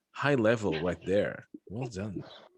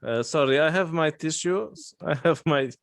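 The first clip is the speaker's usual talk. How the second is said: lightly voiced customer in a higher pitch; put-on higher voice, listing things; mock customer; 6 semitones higher than usual. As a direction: play a needy caller, pitched up. Acts out a porn-seeking internet customer.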